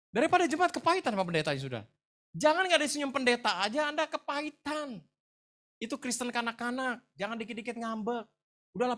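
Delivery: high, loud pulpit exhortation; impassioned preacher, pitched well up; forceful preaching. Real, not acted. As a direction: scold and rally the congregation at full voice.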